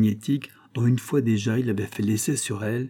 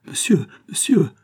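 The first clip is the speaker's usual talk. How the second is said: higher, louder cry of protest; cri alarmé; 7 semitones higher, 5 dB louder than usual. A narrator's reading. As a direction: cry out as you back away from him. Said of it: "s'écria-t-elle en reculant". (cri d'alarme, plus aigu et plus fort)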